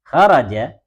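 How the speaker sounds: "Kharaja" with the final a sounded; voweled ending. Shown as a demonstration. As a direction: finish "Kharaja" on an open a. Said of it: "Nggak boleh kharaj".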